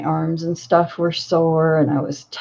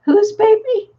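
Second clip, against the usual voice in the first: put-on much higher, baffled "Whose baby?"; woozy daughter; 4 dB louder than usual. Grandmother mimicking her stoned daughter, high and baffled. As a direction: sound drugged and lost.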